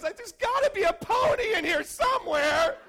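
put-on high, loud, thrilled child's cry; overjoyed kid. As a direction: squeal it like a boy who can't contain his joy. Overjoyed kid answers dad.

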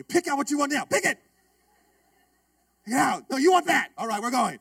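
imitating himself bossing his wife at the menu; mock bossy. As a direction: hurry her through the menu, hammed-up. Rehearsing ordering wife next time.

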